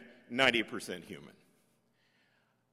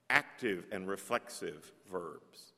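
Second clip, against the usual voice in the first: ordinary talk, nothing marked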